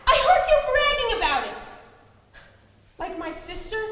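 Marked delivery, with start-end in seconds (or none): 0.1-1.6 louder, higher, accusing; angry accusation. Acted. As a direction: throw it back at her. Girl accusing the aunt.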